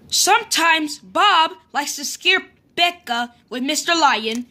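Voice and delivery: ordinary delivery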